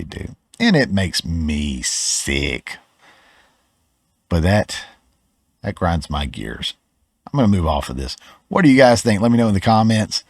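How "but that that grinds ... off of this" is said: ordinary talk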